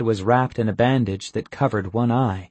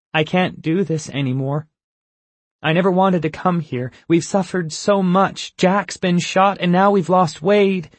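rising to a higher, louder plea; upset outburst; 10 semitones higher, 5 dB louder than usual. Acted. Abigail to the group, refusing to go on, rising upset. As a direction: voice climbing higher as she pleads.

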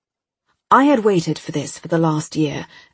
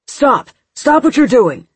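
much higher, loud commanding yell; urgent shout; 8 semitones higher, 8 dB louder than usual. A narrator's reading. Agent shouting at the hacker to stop, urgent yell. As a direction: freeze her with the order.

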